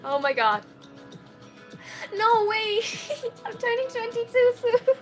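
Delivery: normal talking voice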